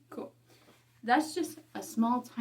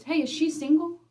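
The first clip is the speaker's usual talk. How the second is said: eager coworker asking; 5 semitones higher, 5 dB louder than usual. Retold or imitated. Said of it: "he goes".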